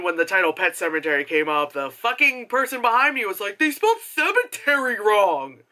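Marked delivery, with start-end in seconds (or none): 3.6-5.6 higher-pitched mock outrage; mocking complaint; mimicked annoying moviegoer. Mimicking annoying person behind them.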